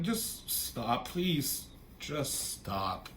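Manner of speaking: soft, sinking mock plea; acted-out defeated pleading; imitated heartbroken plea. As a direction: beg quietly, voice dropping away. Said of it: "it's just, like, heartbreaking for him".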